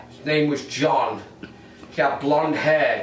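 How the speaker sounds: ordinary delivery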